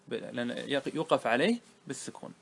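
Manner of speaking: normal talking voice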